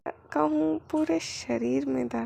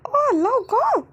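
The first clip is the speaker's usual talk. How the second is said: loud, high cry; startled cry; 10 semitones higher, 8 dB louder, much slower than usual. Acted. Wing crying out to his husband, loud. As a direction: yelp his name.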